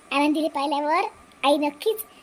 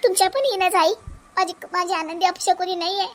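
high, tearful plea to her mother-in-law; begging through tears to defend her daughter; tearful pleading; 6 semitones higher than usual. Acted. Daughter-in-law to mother-in-law, defending her baby girl, tearful high plea. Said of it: "सुनबाई, तू रडू नको".

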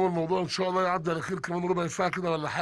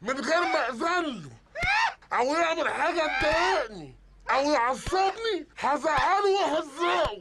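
high, slow menacing warning; a boss laying down the law, slowly; slow threat; 7 semitones higher, slower than usual. Acted. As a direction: draw out each threat to scare them.

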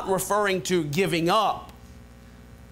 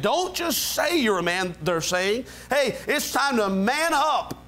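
usual speaking voice

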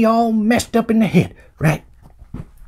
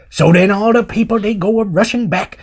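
normal talking voice

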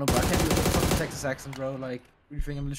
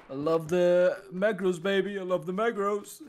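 put-on slow, drawn-out Texas accent, raised pitch; 4 semitones higher, slower than usual. Imitating Texas players to teammate, Texas accent. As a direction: ham up a Texas cowboy accent. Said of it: "in a Texas accent".

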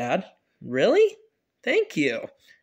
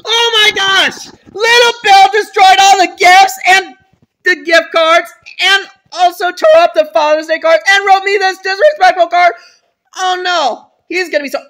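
furious shout; 10 semitones higher, 13 dB louder than usual. Acted. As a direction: bellow in outrage. Dad yells discovery.

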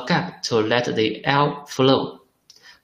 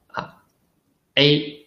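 ordinary talk, nothing marked

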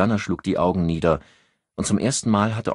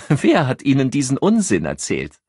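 amused scoff; 8 semitones higher, 6 dB louder than usual. A narrator's reading. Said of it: "Sie schnalzte lachend mit der Zunge und fragte".

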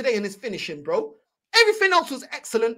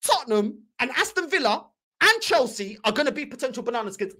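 ordinary talk, nothing marked

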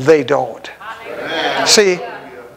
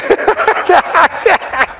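high chuckle; 11 semitones higher than usual. Real, not acted. Laugh after "I'm about done.

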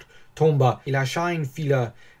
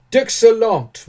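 high, loud, emphatic answer; emphatic reply; 10 semitones higher, 8 dB louder, slower than usual. Acted. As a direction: answer with full conviction. Réponse appuyée de l'armateur. (réponse forte et appuyée)

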